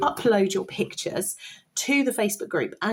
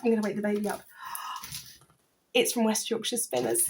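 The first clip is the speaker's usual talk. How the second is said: normal talking voice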